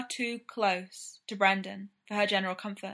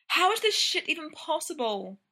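higher-pitched moaned complaint; moaning; 8 semitones higher than usual. A narrator's reading. Brendan trapped in a corset as Ryan works on it, moaning. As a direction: moan it in exasperation at the predicament. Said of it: "Brendan moaned".

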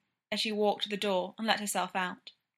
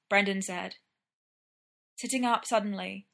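ordinary delivery, nothing marked

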